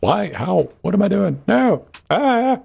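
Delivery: panicked disbelief building up; rising panic. Real, not acted. Player falling in the game.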